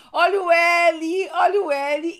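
high, loud, drawn-out street call; imitated vendor's shout. Imitating friend selling at carnival, loud drawn-out call. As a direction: mimic a hawker crying wares. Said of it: "ela começou a gritar assim".

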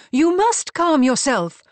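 high, loud, slow and firm; admonishing. A narrator's reading. Wilhelmina tells her husband to calm down, admonishing. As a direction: admonish him word by word. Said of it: "Wilhelmina admonished".

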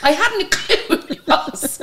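high, slow, through laughter; laughing confession. Real, not acted. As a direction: laugh helplessly while admitting it. Laughing at own ignorance.

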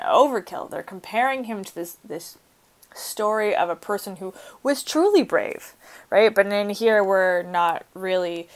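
ordinary talk

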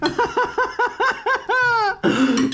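very high-pitched, sustained laugh; real laughter. Real, not acted. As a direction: let out a long high laugh. Laughing over the meal.

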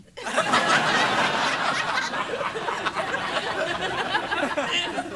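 breathy laugh up high; high airy laugh. Real, not acted. After his Cousteau bit, a breathy laugh. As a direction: let the laugh escape through air.

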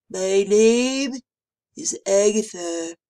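loud congested monster voice; stuffed-up nose. A narrator's reading. Mucus monster introducing herself to the babysitter, stuffed-up voice. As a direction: talk as if through a blocked, sniffly nose. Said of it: "through sniffled noses".

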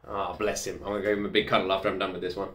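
tender. Real, not acted. Tender aside about her baby.

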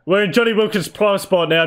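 ordinary delivery

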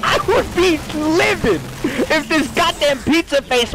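pretend furious shout. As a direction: rant like you'd be livid. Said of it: "I'm gonna be so fucking mad".

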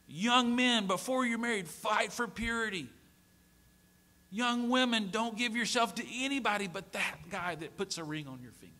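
preaching. Acted. Pastor urging singles in the congregation, earnest preaching. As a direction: urge the young people to stay pure.

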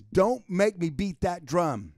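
slow, deliberate "Don't make me beat that drum"; catchphrase. Retold or imitated. Boss repeating his catchphrase to the team, said slowly. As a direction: lean on each word of the saying, slowly. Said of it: "my big saying is".

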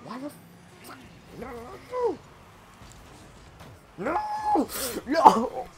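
high panicked protests; frantic no. Real, not acted. Gamer panicking as an opponent scores. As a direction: panic as the play goes wrong.

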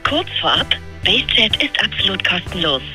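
recorded assistant on a phone line; over the phone. Real, not acted. (übers Telefon)

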